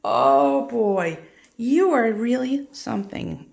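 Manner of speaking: loud, delighted gush at the bird; light up and gush over it; excited gushing. Real, not acted. Owner delighted by her parrot, loud excited gush.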